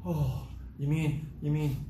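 slow, drawn-out letdown; mock deflated. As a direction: stretch it out like a crestfallen kid. Acting out deflated reaction to criticism.

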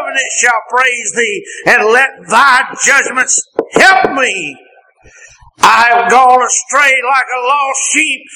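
pleading prayer rising to a high cry; impassioned psalm reading. Real, not acted. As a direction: beg like the psalmist from the pulpit. Psalm prayer preached aloud.

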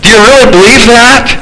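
ordinary delivery

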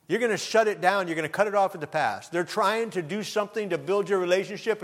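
normal talking voice

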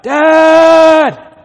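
put-on loud high child's cry. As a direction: call out like a frightened child at night. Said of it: "they could cry out".

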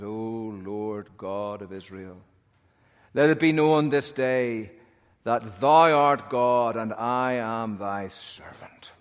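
low, slow, hushed reverent prayer; solemn prayer. Acted. Elijah calmly praying before the altar on Carmel. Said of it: "Elijah steps forward and says".

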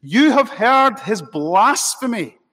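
loud, high-pitched, theatrical outrage; a grand show of being scandalised; melodramatic high priest. Acted. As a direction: declaim it high and loud, hammed-up accusation. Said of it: "another melodramatic display".

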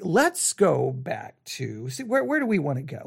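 usual speaking voice

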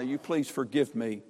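ordinary talk

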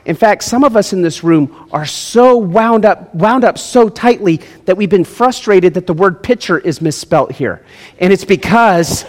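ordinary talk, nothing marked

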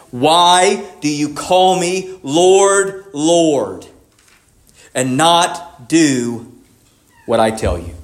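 very slow, weighted, word by word; provoking question. Retold or imitated. Preacher quoting Jesus' question to his followers, slow and provoking. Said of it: "Jesus gives this provoking question".

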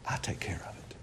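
whispered I take care of it. Acted. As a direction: breathe it as a low whisper. Repeats God's line hushed.

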